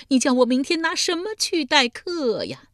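loud, high howl, with 待 pushed hard and the line falling at the end; the lament at its peak, crying it out; wailing. Acted. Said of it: "嚎啕大哭道". (哭喊到顶点，重读“待”)

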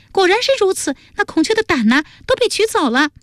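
very high, put-on girlish voice; high, animated female character; excitable young woman. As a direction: gush out the tale impatiently. Voices Jialuo in the story.

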